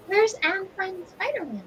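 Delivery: high-pitched, playful searching question; searching companion. Acted. Player looks around for a missing companion, high searching voice. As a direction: ask it high, as if looking around. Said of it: "taking a look around and going".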